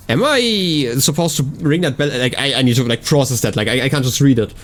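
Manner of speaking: ordinary talk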